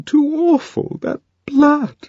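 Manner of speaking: high distressed voice, rising on that blood; horrified and close to tears; high shaken cry; tearful. A narrator's reading. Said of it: "Lenina was still sobbing".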